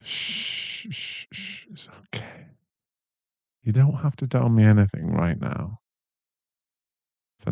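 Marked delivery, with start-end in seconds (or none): 0.0-2.5 whispered shushing, soft and slow; soothing shush. Acted. Boyfriend whispering shh to his shaken girlfriend, soothing. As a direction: hush her gently like calming a child. Hushing a frightened partner.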